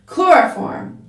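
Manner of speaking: loud, high, sudden call; shouted. A narrator's reading. Timothy calling his discovery from across the room, shouting. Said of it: "shouted from the, from across the room".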